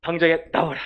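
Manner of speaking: loud, high command; strong command. Acted. Jesus ordering the demon out, strong loud command. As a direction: bark the order out. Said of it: "강하게 명령을 해요".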